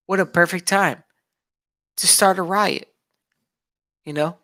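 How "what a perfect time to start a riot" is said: bitter sarcasm. Real, not acted. Vlogger angry about riots, sarcastic raised voice. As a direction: spit out the irony.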